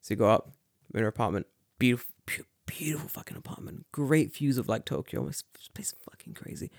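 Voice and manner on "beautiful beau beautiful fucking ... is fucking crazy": awed whisper. Retold or imitated. Awed whisper about apartment.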